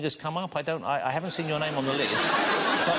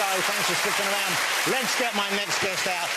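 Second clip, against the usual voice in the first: high, energetic show host; TV host announcement; 5 semitones higher than usual. Acted. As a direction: welcome the audience back.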